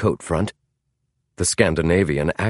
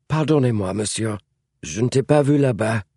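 higher-pitched, startled French apology; managed with effort; 3 semitones higher than usual. A narrator's reading. James apologizing to a stranger in the dark, managed with effort. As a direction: get the French apology out after a fright. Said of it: "he managed to say".

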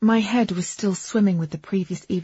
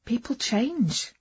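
higher, drawn-out hopeful suggestion; 6 semitones higher, much slower than usual. A narrator's reading. Companion trying to console a hurt woman, tentatively hopeful. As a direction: offer it gingerly, as a hope. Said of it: "I said hopefully".

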